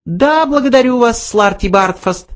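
high, casual little mouse voice; offhand mouse. A narrator's reading. Mouse thanks the old man, offhand high voice. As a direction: brush him off lightly, pitched high. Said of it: "небрежно произнёс Бенджи".

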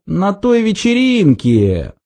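high, slow, sheepish recognition; embarrassed. A narrator's reading. Zaphod admits he remembers the party, embarrassed. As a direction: stretch it out sheepishly. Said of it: "смутившись, проговорил Зафот".

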